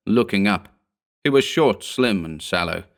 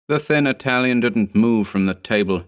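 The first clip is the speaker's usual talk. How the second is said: usual speaking voice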